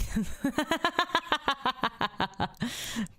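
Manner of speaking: giggling high laugh. Real, not acted. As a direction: laugh at your own joke. Laughs at own joke.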